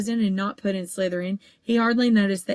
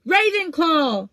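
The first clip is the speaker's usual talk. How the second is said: slow, loud, much higher drawn-out call; shouted; 8 semitones higher, 6 dB louder, slower than usual. A narrator's reading. Sorting Hat announces to the hall, a drawn-out shout. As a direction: stretch the house name out to the hall. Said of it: "the Hat shouted out the house".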